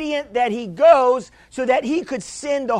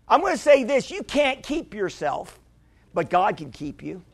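ordinary talk

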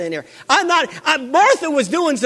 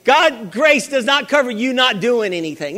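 normal talking voice

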